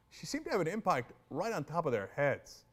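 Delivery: usual speaking voice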